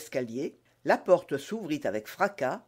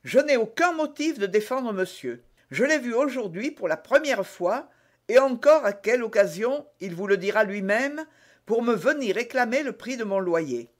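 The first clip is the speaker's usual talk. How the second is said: raised pitch, public disavowal; out loud; 6 semitones higher, 4 dB louder than usual. A narrator's reading. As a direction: declare it for all to hear. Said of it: "dit tout haut d'Artagnan". (déclaration à voix haute)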